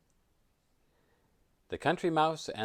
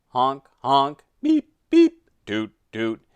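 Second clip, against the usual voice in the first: imitated car horns; 3 semitones higher, 12 dB louder than usual. A narrator's reading. Storyteller voicing city traffic on the ride, honking. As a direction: toot like busy traffic horns.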